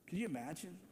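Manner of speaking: ordinary talk, nothing marked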